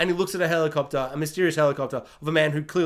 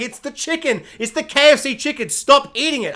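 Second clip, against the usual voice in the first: mock scolding doctor; 7 semitones higher, 4 dB louder than usual. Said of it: "They're like".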